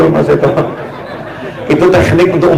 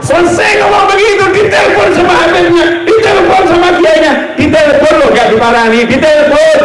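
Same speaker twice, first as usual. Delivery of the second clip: indignant shout; 10 semitones higher than usual. Real, not acted. Preacher scolds congregation, high indignant shout. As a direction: shout the hypocrisy at the crowd, much higher.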